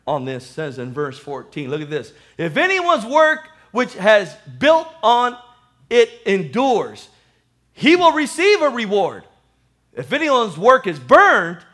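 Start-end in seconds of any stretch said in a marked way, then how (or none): 2.4-11.6 emphatic scripture reading. Real, not acted. Reads the verse out to congregation.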